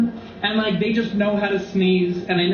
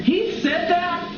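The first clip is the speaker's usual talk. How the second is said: normal talking voice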